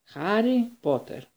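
high, slow, drawn-out mystic call; a name called out high and slow; Trelawney calling out. A narrator's reading. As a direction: summon him like a seer from the mist. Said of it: "τη φωνή της καθηγήτριας Τρελόνη να το φωνάζει".

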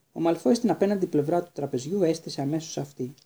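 ordinary delivery

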